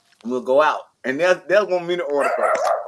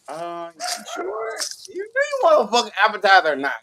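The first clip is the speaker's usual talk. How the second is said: impatient mock question; 7 semitones higher than usual. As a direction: push them to hurry up and order. Mocking an indecisive date.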